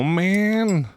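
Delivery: exasperated high "man". Real, not acted. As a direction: let it rise in disbelief. Axe not picked up.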